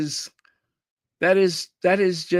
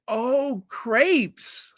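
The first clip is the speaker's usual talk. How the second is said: high-pitched "oh" of sudden understanding; retold moment of getting it, pitched well up; dawning realization; 9 semitones higher than usual. Storyteller reenacting finally understanding the guest, surprised realization.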